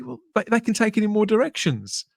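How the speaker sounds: ordinary talk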